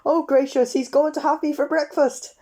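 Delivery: cower and wail; panicky wail. A narrator's reading. Mr Jelly fearing the giant tramp, high panicky wail.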